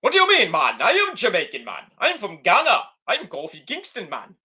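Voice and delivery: mock Jamaican accent, swooping pitch; indignant protest in an imitated Jamaican accent. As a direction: put on a bouncy, exaggerated Jamaican accent. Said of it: "went back to the Jamaican accent".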